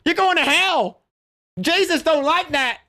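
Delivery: high, whiny mock outrage; mocking Melanie Mac. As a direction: squeal like a scandalized prude. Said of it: "She's like".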